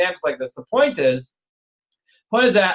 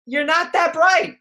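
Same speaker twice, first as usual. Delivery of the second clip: a lightly voiced professor, high and slow, letting you down with mock politeness; respectful but crushing verdict, mildly hammed-up; condescending professor; 7 semitones higher, slower than usual. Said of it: "but he says to you basically".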